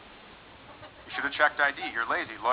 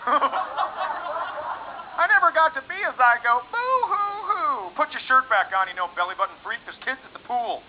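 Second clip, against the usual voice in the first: much higher, slow, whiny crybaby; whiny mock cry; 9 semitones higher, slower than usual. Test tube baby whining about never being a zygote. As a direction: blubber like a sulky kid.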